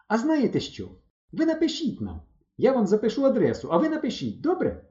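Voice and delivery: high-pitched, hopeful pleading; eager girl. A narrator's reading. Valka asking the boys to write, eager.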